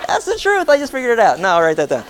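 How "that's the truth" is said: high laughing exclamation through a chuckle. Real, not acted. Preacher laughing at his own joke with the congregation, laughing.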